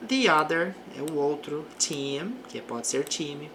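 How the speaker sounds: ordinary talk, nothing marked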